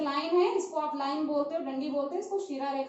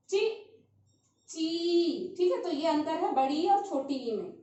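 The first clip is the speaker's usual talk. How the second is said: ordinary delivery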